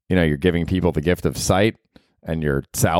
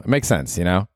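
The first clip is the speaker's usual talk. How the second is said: usual speaking voice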